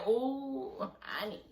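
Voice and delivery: mock sympathetic hush; knowing "oh honey". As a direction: let it drop to a pitying murmur. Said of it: "I said".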